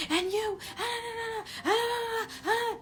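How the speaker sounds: hammed-up crying shriek; mock wailing yell. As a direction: imitate someone screaming and wailing at you. Imitating friend's wailing yell.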